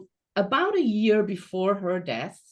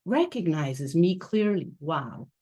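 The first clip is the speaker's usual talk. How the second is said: normal talking voice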